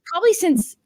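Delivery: ordinary talk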